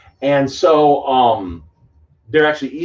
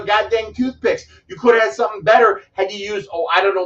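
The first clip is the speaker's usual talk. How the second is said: usual speaking voice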